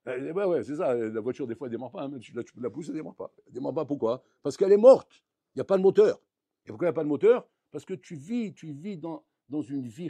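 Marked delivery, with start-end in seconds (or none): 4.4-6.2 loud, higher-pitched exclamation; emphatic outburst. Real, not acted. Rabbi answering his own question to the audience, emphatic outburst. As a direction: burst out with the blunt answer. Punchline of stalled car image.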